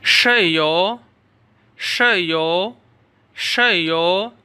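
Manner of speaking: शयो pronounced slowly as Chinese shèyǒu; drawn-out model of शयो for students; teacher leading the class through शयो; repeat after me. Shown as a demonstration. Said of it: "आप सारे तलबा मेरे साथ पढ़ते जाएं".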